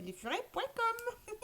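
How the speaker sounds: high, slow singsong "lesfurets point com" like a TV ad tag; mock ad jingle. Shown as a demonstration. Streamer parodying the lesfurets.com TV ad, slow high singsong. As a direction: croon the brand name as a jingle. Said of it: "Je fais de la pub".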